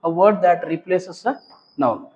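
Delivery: measured, word-by-word reading; drawn-out teacher dictation; slow dictation. Shown as a demonstration. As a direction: read out a definition slowly for the class. Dictating second definition.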